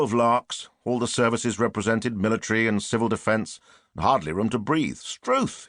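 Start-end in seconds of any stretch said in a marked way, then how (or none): 5.0-5.7 a sudden high English public school exclamation; public school accent. A narrator's reading. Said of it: "in his public school accent".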